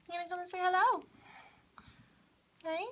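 high coaxing voice for a pet; coaxing pet talk. Real, not acted. Vlogger invites her cat on camera, high coaxing pet talk.

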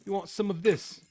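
high, loud, hammed-up British street accent; playful mock-British taunt while fighting in Minecraft; mock British chav. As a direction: squeak out a tough-guy British challenge. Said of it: "That sounds more like Australian".

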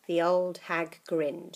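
ordinary delivery, nothing marked

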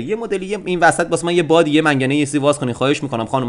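usual speaking voice

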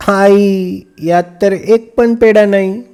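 put-on girl to mother over the empty sweet box; child crying out. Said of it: "Leela ओरडली".

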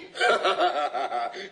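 a short sneering laugh rising up; sinister amused chuckle; mocking laugh. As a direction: laugh them off with scorn. Hanged man mocks the scientists.

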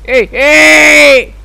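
loud, high cry of no; shouted protest. Real, not acted. Player protesting at the game, drawn-out shout. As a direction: yell out the second no long.

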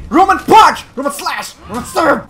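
high, loud attack calls; hammed-up combat yell; battle cries. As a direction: shout each move name like a fighter. Shouts character's attack names.

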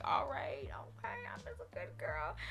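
hushed, whispery pet voice; voiced dog. Owner voicing her praised dog, whispery little voice. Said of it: "It hits their eardrum in a different way".

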